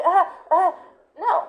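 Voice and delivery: high, hesitant stammer in a different voice; a confused dog stuttering; flustered dog. Retold or imitated. Dog confused by correction, high hesitant stammer. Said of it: "Dog's gonna be like".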